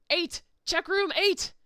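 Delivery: loud, high, eager shout; excited call. Real, not acted. Player excitedly calls out room eight to the others. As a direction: call out the room like you just solved it.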